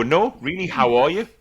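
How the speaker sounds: demanding an honest answer from a colleague; insistent question. Retold or imitated. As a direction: press them for a real answer. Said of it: "And I go".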